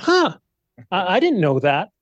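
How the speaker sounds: intrigued surprise, played up in retelling; mock surprise. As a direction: act out being taken aback by news. Retells own surprise at the news.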